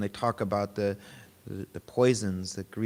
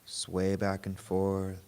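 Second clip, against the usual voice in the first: guided meditation; 3 semitones lower, 3 dB quieter than usual. Real, not acted. Teacher guiding a group to notice body and breath, guided meditation. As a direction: lead them gently inward, unhurried and even.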